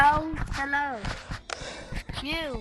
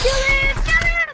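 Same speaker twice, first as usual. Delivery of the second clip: shouted high, calling for a kill; urgent yell; 8 semitones higher, 5 dB louder than usual. Real, not acted. Kid yelling to teammate to kill the enemy, loud and urgent. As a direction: yell it at your teammate mid-fight. Urgent game combat callout.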